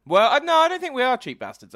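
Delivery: high, loud indignant denial; indignant protest. Real, not acted. To friend who called them cheap, a loud indignant protest. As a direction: protest loudly, affronted.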